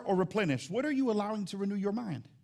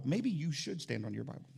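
ordinary talk

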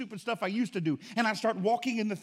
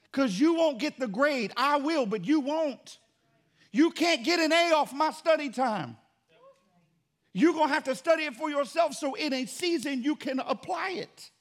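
raised, emphatic preaching voice; preaching force; 6 semitones higher, 3 dB louder than usual. Real, not acted. Pastor warning congregation to study for themselves, raised and emphatic. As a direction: press it home to the congregation.

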